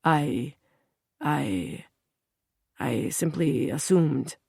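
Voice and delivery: a nervous falter, fading out. A narrator's reading. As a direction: trip over the start, then trail off quietly. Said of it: "The journalist falters as he speaks".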